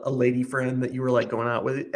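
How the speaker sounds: ordinary delivery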